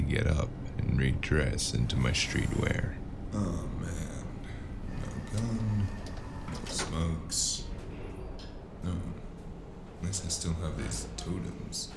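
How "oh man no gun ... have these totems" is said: soft, half-whispered grumble to no one; muttering to himself. Acted. Man searching his pockets, muttering to himself disappointed. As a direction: mutter it under your breath, half voiced.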